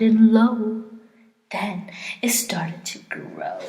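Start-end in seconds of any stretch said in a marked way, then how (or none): 1.5-3.6 hushed. A narrator's reading. Suspense before Whoville's song.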